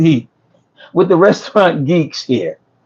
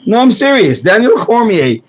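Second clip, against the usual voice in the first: ordinary talk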